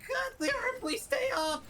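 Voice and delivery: high, urgent plea; frantic pleading. Real, not acted. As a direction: beg it to hold on, panicked. Panicked plea at failing game.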